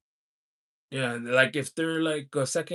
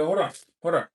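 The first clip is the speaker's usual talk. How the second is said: ordinary talk, nothing marked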